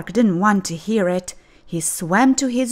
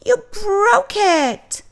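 high, louder, slow; upset accusation; 5 dB louder, slower than usual. Acted. Joey upset at Clark for breaking it, high. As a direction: wail it at your friend.

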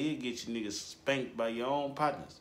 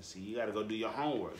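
ordinary talk

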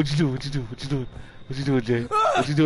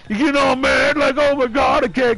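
high-pitched indignant yelling; mock outrage; 5 semitones higher than usual. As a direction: rant at the intruder. Scolds killer for breaking windows.

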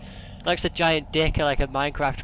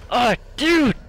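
startled yelp; 8 semitones higher, 6 dB louder than usual. Real, not acted.

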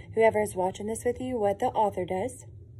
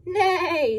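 horse neigh; 8 semitones higher, 4 dB louder than usual. Acted.

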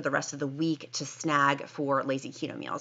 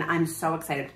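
ordinary talk, nothing marked

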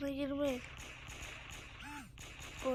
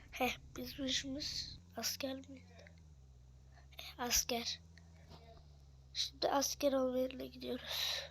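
hushed, voiceless whisper; 9 dB quieter than usual. Real, not acted. Gamer confides his soldier plan to viewers, whispering. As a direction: whisper it like a secret aside.

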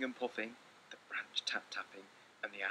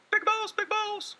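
much higher, louder, slow little voice; calling little mouse; 13 dB louder, slower than usual. Acted. Little Mouse calling his brother at night, high squeaky call. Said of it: "called Little Mouse".

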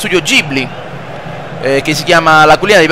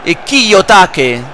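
live play-by-play; 3 semitones higher than usual. Acted. Plays football video game commentating.

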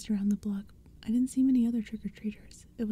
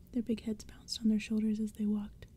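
ordinary delivery

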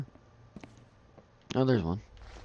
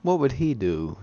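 usual speaking voice

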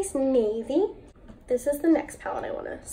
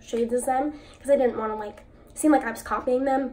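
ordinary talk

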